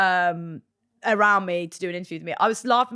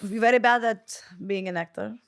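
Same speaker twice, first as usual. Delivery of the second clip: a light mock Spanish teacher accent; imitating her Spanish drama teacher, slowing down; 2 semitones higher than usual. Drama student mimics her Spanish teacher's verdict, in a light mock Spanish accent. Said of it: "she was Spanish and she was like".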